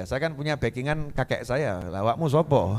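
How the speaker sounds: ordinary talk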